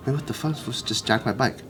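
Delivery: quietly baffled, half to yourself; stunned realization. Retold or imitated. Slow realization of theft, retold.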